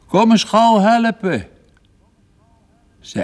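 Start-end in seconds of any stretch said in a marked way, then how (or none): 0.0-1.5 high, loud cry for help; calling out. A narrator's reading. Father calls to his daughter for help, calling out. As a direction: call urgently across the house for a hand. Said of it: "die riep".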